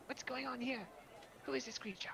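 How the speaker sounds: tiny high fairy voice, rushed and tumbling; rapid sprite voice. Acted. Fairy sprite demanding answers of the stranger, high and rapid. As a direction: trip over the words like a buzzing sprite. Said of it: "it's very rapid. He almost trips over his own tongue".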